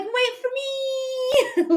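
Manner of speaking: high, very slow, whiny little voice; tiny pleading soul. Pretend. Her unborn soul calling after her brother, high pleading whine. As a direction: stretch it out like a tiny soul begging to be waited for.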